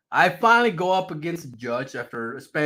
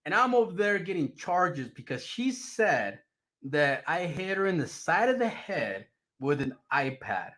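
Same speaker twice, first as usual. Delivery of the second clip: ordinary talk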